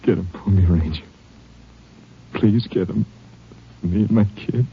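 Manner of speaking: halting, spent plea; weak plea. Acted. Wounded father begging the Ranger to get the killer, weakly pleading. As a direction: beg with the last of your strength.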